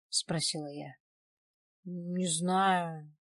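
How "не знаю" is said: drawn-out, pondering "не знаю"; thoughtful. A narrator's reading. Man asked what he was strangled with, pondering. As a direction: say it slowly, searching your memory. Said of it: "Владик задумался".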